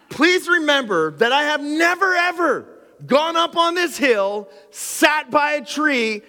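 high, loud, fired-up sermon delivery; full-force preaching. Acted. Pastor fires up his congregation, loud, high full-force preaching. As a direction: preach it out big to the whole room.

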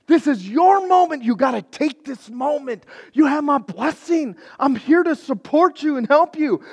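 high, loud urging; urgent encouragement. Retold or imitated. Elisha urging the king to take his moment, loud and high. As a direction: push him to seize the moment.

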